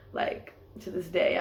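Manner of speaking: ordinary talk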